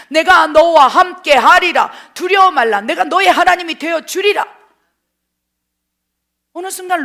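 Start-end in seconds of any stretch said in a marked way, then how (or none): 0.1-1.9 내가 너와 함께 하리라 shouted louder, slow; slow booming proclamation; God's booming voice. Acted. Quoting God's promise, shouted. 2.1-4.5 loud preacher's shout as God; full-force preaching shout; booming proclamation. Acted. God's promise proclaimed loudly.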